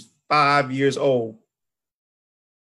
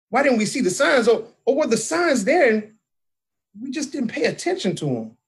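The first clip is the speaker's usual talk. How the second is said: high, searching questions to oneself; raised pitch, wondering what was missed; anguished self-questioning; 6 semitones higher than usual. Real, not acted. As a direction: ask it higher, troubled by missed signs. Questions herself over missed signs.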